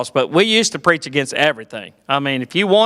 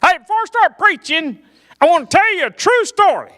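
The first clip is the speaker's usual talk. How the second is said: high, earnest preacher announcement; mock pastor voice; 11 semitones higher than usual. Pastor announcing a true story, high preacher voice. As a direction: play the pulpit intro straight. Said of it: "You ever heard a pastor say that".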